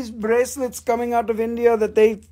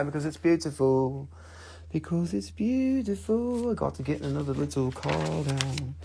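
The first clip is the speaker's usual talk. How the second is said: hushed put-on voice; 5 semitones lower, 10 dB quieter than usual. Playful put-on sales voice.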